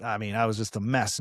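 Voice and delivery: ordinary delivery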